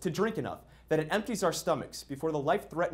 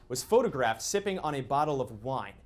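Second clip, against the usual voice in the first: ordinary talk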